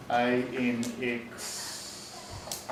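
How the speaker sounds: letters spelled out slowly; slow school dictation. Shown as a demonstration. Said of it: "teacher demos spelling aloud".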